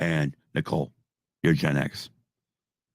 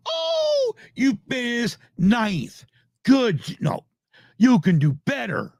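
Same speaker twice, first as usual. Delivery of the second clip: high, slow sing-song praise that takes itself back; mock coddling parent; 11 semitones higher, slower than usual. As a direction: coo like an overpraising parent, then correct yourself.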